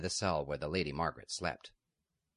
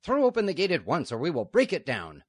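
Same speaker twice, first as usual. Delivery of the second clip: much louder and higher threatening shout; rough threat; 10 semitones higher, 9 dB louder than usual. Acted. Outlaw threatening to break down the gate, loud shout. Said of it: "a rough voice said".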